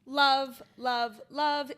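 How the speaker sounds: loud gushing love, love, love; excited. Real, not acted. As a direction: gush it, all enthusiasm. Enthusing about Charlestown night out.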